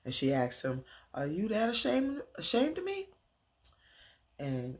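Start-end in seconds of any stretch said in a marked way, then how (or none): 1.1-3.1 mock daughter's high, hurt cry; hurt plea. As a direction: voice climbs very high on ashamed of me. Retelling Angela's hurt plea.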